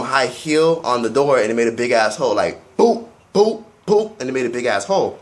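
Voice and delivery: ordinary talk, nothing marked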